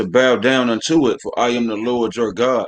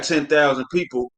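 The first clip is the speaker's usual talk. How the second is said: normal talking voice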